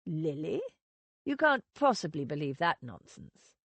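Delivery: a hint of a scornful husband brushing her off; Edward. A narrator's reading.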